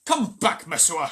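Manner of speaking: loud, high angry call; crowd shouting. A narrator's reading. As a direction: order her back with a yell. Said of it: "shouted the crowd".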